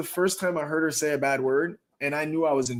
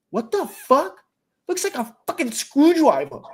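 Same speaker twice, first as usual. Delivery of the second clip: higher, lightly voiced five-year-old; little girl voice; 9 semitones higher, 4 dB louder than usual. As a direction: mimic a small kid, puzzled. Imitating five-year-old daughter.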